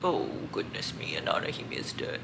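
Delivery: deadpan mock gentility; mock prim lament. Reader mocking her own reaction in a low prim voice. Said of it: "So I'm just like".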